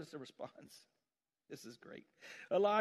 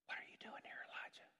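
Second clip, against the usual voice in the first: breathy whispered question, barely voiced; 21 dB quieter than usual. God whispers his question to Elijah on the mountain, in a whisper. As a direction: whisper it like a still small voice. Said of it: "And then he whispers".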